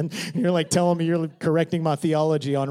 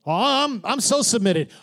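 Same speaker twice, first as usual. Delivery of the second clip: mock piety; 6 semitones higher than usual. Mocking shallow submission.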